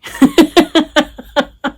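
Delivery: high burst of laughter; loud delighted laugh. Real, not acted. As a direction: crack up at being right. Laughing at predicted rain.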